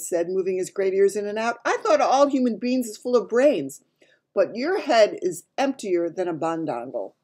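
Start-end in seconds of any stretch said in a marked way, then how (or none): 1.6-7.1 a touch of giant bluster; lightly voiced giant, chiding; lightly voiced scornful giant. A narrator's reading. Giant belittling Sophie's brains, mildly scornful.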